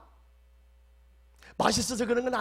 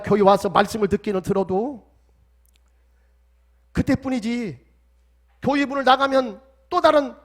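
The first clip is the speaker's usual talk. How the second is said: impassioned, loud prayer over music; fervent prayer; 8 dB louder than usual. Acted. Pastor praying aloud for the congregation, fervent and pleading. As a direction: pour your heart out to God.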